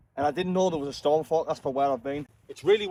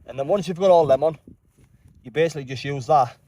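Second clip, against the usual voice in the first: loud, raised voice outdoors; shouting over wind; 6 dB louder than usual. Real, not acted. Assembling tent in a storm.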